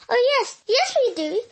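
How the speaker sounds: a bright chorus of yes; eager chorus. Acted. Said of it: "chorused the other animals".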